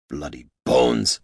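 low, slow curse; drawn-out, low-pitched oath; swearing oath. A narrator's reading. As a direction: swear slowly under the weight of it. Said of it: "swore Gorm".

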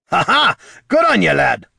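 loud, high cheer; hollered. Acted. Gorm cheering Jin on, loud hollering. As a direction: whoop it with joy. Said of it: "hollered Gorm".

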